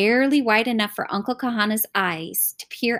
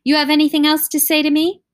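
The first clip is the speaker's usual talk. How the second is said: louder, higher, fast challenge; stern demand; 7 semitones higher, 6 dB louder, faster than usual. A narrator's reading. Uncle demanding more from the apologizing children, stern. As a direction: press them sharply for an answer.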